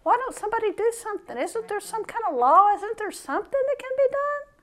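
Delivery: high, pleading whine of a fed-up believer; lamenting to the Lord, voice pushed way up; exasperated prayer. Retold or imitated. Preacher imitating his own weekly complaint to God, high and pleading. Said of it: "all week kept saying".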